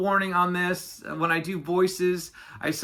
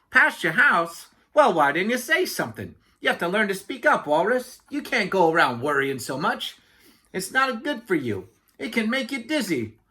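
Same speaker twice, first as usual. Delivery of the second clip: higher-pitched lecturing chatterbox; oblivious Yak; 4 semitones higher than usual. Acted. Yak blaming Walrus for not speaking up, chatty lecture. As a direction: scold lightly, missing the point entirely.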